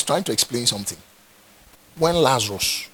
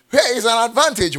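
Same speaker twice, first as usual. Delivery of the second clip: raised voice, punch advantage; preacher hammering the phrase louder; louder emphatic repeat; 8 semitones higher, 7 dB louder, faster than usual. Acted. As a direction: drive the point home louder.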